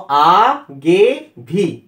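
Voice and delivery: आगे भी slowly split into three separate syllables; आगे भी in three drawn-out, detached syllables; broken into syllables. Shown as a demonstration. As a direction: say आगे भी one syllable at a time, with pauses. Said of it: "syllable में भी ... तोड़ के".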